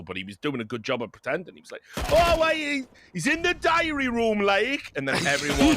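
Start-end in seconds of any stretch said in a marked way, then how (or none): 2.1-4.9 high, louder mock Geordie accent; hammed-up Big Brother Geordie accent. Caster imitating the Big Brother narrator, mock Geordie accent. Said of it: "he was from Newcastle".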